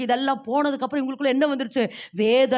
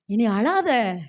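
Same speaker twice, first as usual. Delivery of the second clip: low, very slow and gentle; tender comfort; 5 semitones lower, much slower than usual. Acted. Jesus gently comforting the weeping woman, low and slow. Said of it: "ஏசப்பா எடுத்த உடனே சொல்றாரு".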